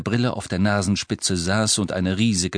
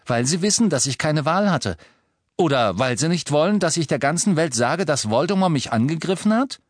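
Harry, aufgebracht; 7 semitones higher than usual. Acted. Harry fordert Mr Weasley heraus.

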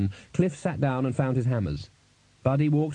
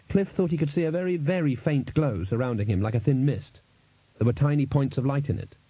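ordinary delivery

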